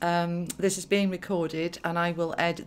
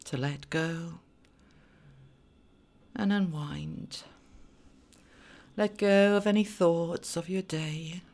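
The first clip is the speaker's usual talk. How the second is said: hushed voice guiding a meditation; guided meditation. Acted. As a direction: ease them into calm with long pauses. Leads a meditation.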